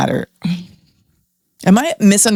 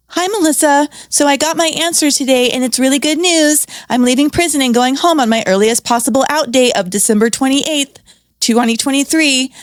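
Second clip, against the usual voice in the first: higher voice reading the inmate's happy news; book dialogue reading; 6 semitones higher than usual. Acted. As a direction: read it like the excited caller. Reads the memoir's phone dialogue.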